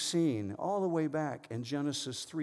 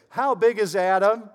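put-on higher, louder singsong to a toddler; parent's playful question; 7 semitones higher, 10 dB louder than usual. Father playing a game with his toddler son, playful question. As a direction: ask it big and playful, like to a little one. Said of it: "and we'd say".

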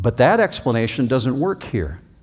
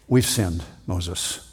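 soft, lower, hushed confession; contrite confession; 8 semitones lower, 6 dB quieter, slower than usual. Acted. People confessing sin to Moses, hushed and sorry. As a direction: own up quietly to Moses.

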